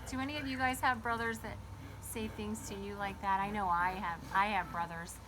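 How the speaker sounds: ordinary talk, nothing marked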